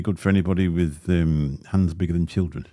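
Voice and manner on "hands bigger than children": normal talking voice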